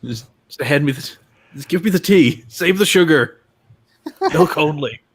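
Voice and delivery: hammed-up British driver insisting on tea; fake British accent. Driver demands tea in the pit, in loud fake British accent. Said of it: "with the worst fake British accent".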